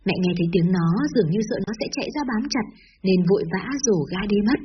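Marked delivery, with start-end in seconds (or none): none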